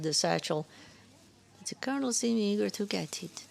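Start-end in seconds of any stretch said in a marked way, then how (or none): 1.6-3.4 put-on old woman's teasing French accent; coy old woman with a French accent. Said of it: "heavy French accent".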